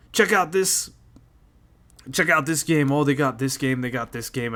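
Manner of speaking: mild mock teenage excitement; mocking his kids. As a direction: imitate the kids gushing over a game. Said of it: "talking how my kids talk".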